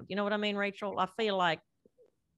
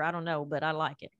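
ordinary talk, nothing marked

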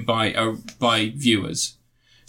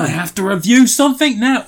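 parroting back a demand, pitched up and louder; mocking echo; 9 semitones higher, 6 dB louder than usual. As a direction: repeat it back like a sulky kid. Echoing the co-host's demand mockingly.

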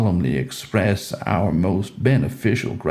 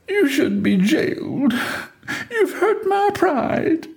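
Delilah sulking. Acted. Delilah scolding Samson.

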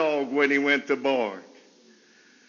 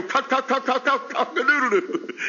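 an excited rooster stuttering out its crow, higher; a playful stuttered cock-a-doodle-doo; 6 semitones higher than usual. Retold or imitated. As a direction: cluck out the crow in stuttered bursts, pitched up. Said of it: "he gets kinda excited".

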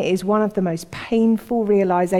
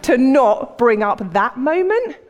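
raised, excitable voice with amusement; comic frantic avoidance; 4 semitones higher, 4 dB louder than usual. Acted. Preacher imagining dodging Peter's awkward moment, comically frantic. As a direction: keep it high and hammed-up, half laughing.

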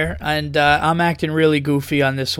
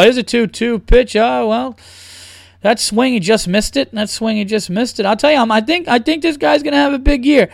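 baseball announcer; 6 semitones higher than usual. Pretend.

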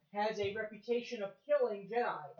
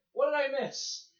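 surprised; 6 semitones higher, 8 dB louder than usual. Retold or imitated. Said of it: "It's like".

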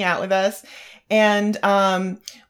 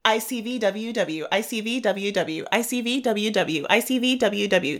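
rattling off I C V W W over and over; a fast repeated tongue twister; 3 dB quieter than usual. Shown as a demonstration. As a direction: say it quickly, again and again. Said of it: "Say it ten times fast".

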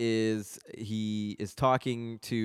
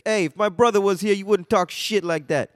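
acted defiant retort, higher and louder; Vinny; 8 semitones higher, 6 dB louder than usual. Vinny standing up to Gino in the bar, raised-voice mock defiance. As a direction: push back as Vinny, raised voice. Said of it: "And Vinny's like".

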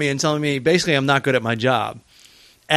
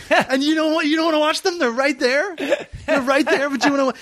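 high, exasperated impression; hammed-up disbelief in a raised pitch; mock incredulous scolding; 11 semitones higher than usual. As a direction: point at them like it's obvious. Bert continuing his rant.

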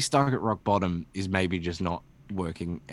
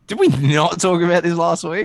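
mock outrage; 8 semitones higher, 8 dB louder than usual. Real, not acted. Mock outrage at repeated topic.

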